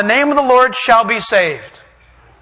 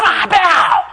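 high, loud mocking shout; jeering yell to make someone bow; mock angel order; 7 semitones higher, 3 dB louder, slower than usual. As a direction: shout a command, hammed up. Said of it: "angels aren't gonna be sitting there".

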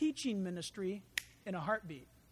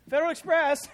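laughing while calling out a joke; 5 semitones higher, 11 dB louder, faster than usual. Acted. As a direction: call it out loud, through a laugh. Joking, calling out in the desert.

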